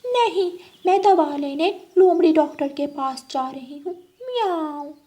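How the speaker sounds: high, sweet-talking cat voice with meows; a scheming cat luring her friend; high purring cat voice. Acted.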